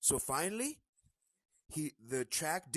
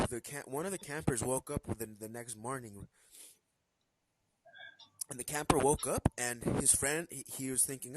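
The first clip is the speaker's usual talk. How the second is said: ordinary talk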